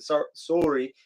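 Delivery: "sorry" with a round "oh": SORE-ee; rounded Canadian first vowel in "sorry". Shown as a demonstration. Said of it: "I'll hear 'em say the word".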